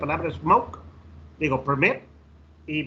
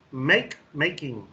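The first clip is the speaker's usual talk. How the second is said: slow, deliberate teacher model of each form; slow modelling; much slower than usual. Shown as a demonstration. Teacher modelling for students: slow and deliberate. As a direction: lay out both words slowly for the class. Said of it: "teacher modelling verb and gerund".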